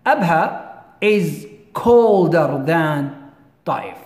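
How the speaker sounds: slow, careful teacher reading; slow dictation. Shown as a demonstration. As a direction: spell it out slowly for the class. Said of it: "teacher slows example for dictation".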